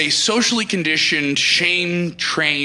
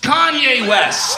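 slow shout ending on a rise; hype shout, rising; 6 semitones higher, slower than usual. Acted. Comic repeating the name, slow rising shout. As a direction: stretch it and lift the end.

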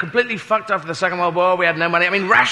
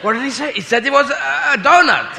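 higher-pitched mock puzzlement; puzzled crowd; 6 semitones higher than usual. Berliners ask each other what was said, mock puzzled. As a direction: act a baffled listener checking with a neighbour. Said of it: "So the people in Berlin must have gone".

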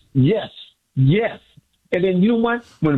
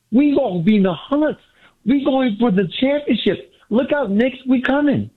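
excited; 7 semitones higher than usual. Real, not acted. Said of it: "You sound so excited".

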